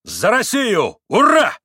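loud, high, ringing hurrah; proclaimed toast. A narrator's reading. Mitya toasts Russia before the company, proclaiming loudly. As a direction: proclaim it like a cheer. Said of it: "провозгласил он снова".

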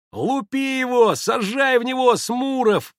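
high, loud rallying cry; shouting boys. A narrator's reading. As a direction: yell it like a gang of boys. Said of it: "закричали все". (азартный мальчишеский крик)

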